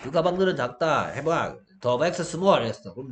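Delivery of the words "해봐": ordinary talk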